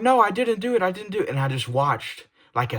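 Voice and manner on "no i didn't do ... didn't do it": imitating crying child. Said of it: "But she was crying".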